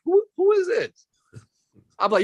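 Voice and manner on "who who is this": much higher puzzled voice; squeaky confused caller; confused high friend. Retold or imitated. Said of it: "They're like".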